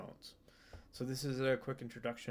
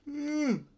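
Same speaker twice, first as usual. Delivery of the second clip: long loud yawn rising high; big yawn; 9 dB louder than usual. Real, not acted. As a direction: yawn out loud mid-sentence. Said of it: "Chronic yawner".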